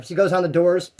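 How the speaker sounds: ordinary talk, nothing marked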